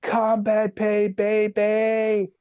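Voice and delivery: high, drawn-out celebratory holler; stretched, high-pitched mock battle cry; gleeful scream back. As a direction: imitate a sergeant whooping across a firefight. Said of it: "he screams back".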